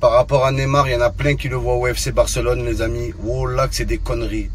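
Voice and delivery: low, hyped insider gossip; low-voiced football rumour; transfer rumour report. Mock transfer news report.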